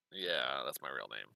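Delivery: very low, quiet muddy voice; deep creature voice. Acted. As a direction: drop way down for a mud creature. DM voices Puddlemudd, much lower.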